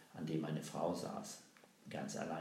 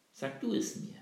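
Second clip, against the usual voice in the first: challenging question; 5 dB louder than usual. Acted. Girl challenges strange woman.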